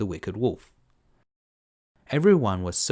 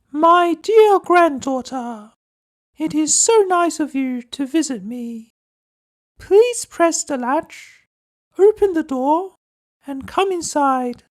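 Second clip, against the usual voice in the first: much higher, louder, slow quavery granny; old granny voice; 9 dB louder, slower than usual. Acted. Grandmother calling through the door, high and slow. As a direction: call out like a frail old grandmother. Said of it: "said the grandmother".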